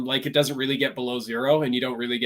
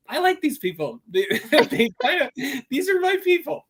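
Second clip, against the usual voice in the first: high, laughing gush of affection; laughing delight; 12 semitones higher, 4 dB louder than usual. Retold or imitated. Guest gushing to hosts about Southerners, high laughing delight. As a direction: giggle it out, thrilled to find your people. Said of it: "I'm like".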